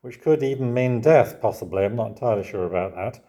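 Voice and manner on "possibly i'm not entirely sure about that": ordinary delivery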